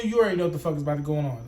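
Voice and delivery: ordinary talk, nothing marked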